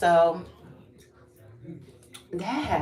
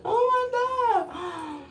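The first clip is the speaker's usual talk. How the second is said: much higher, slow, wailing and louder; mock crying girls; 5 dB louder, much slower than usual. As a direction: imitate the sobbing girls in a high wail. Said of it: "They were crying".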